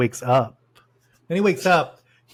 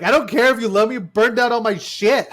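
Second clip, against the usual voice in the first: higher, louder mock outrage; acted angry mom; 6 semitones higher, 4 dB louder than usual. Mom answering her daughter's apology, mock outrage. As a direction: throw back an indignant retort, half laughing. Acts mom's angry comeback.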